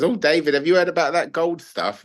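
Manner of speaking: a chatty South African neighbour gossiping over the fence; nosy neighbour leaning over the fence, in a put-on South African accent. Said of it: "I can't do a South African accent".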